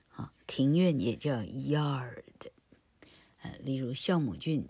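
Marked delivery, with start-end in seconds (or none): 1.4-2.2 yard drawn out, falling, with a long y glide; slow teaching take of yard; model pronunciation. Shown as a demonstration. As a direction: stretch yard slowly for learners. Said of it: "[name]念给你听哦".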